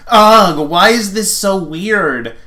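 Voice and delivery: a touch of mock frustration; frustrated groan. Character voiced frustrated.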